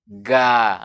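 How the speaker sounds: GA with mouth open, A thin and clear; flat-tongued open GA; terhampar. Shown as a demonstration. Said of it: "terhampar, terbuka mulut". (GA dibaca tipis, mulut terbuka)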